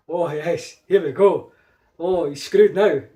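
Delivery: loud, relishing fan reaction; retold gleeful excitement. Star Wars fan reliving a cinema moment, gleefully excited.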